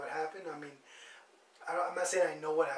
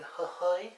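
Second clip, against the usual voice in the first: mock hurl sound; 2 semitones higher than usual. Shown as a demonstration. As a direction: fake retching noise. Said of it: "That's how I do it".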